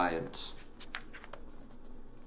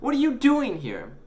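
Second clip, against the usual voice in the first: high, louder exasperated question; 7 dB louder than usual. A narrator's reading. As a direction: demand it, fed up with him. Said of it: "exasperated now".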